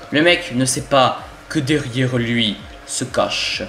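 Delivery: slow, drawn-out ominous build-up; play-acted thriller narrator; mock-suspense narration. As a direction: stretch each phrase towards a reveal. Narrates sneaking up behind a player.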